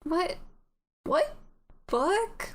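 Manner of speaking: high, questioning voice behind a door; puzzled, high-pitched short questions; wary Min. Acted.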